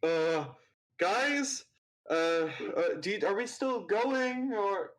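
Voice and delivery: nervous servant, unsure, trailing off; timid assistant voice. Acted. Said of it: "assistant Patrick lowers the rickshaw and goes".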